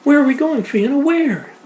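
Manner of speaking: very high, loud gasping question; girl running from the fire, high and breathless; breathless panic. Acted. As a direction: pant it out while running. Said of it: "Alice panted".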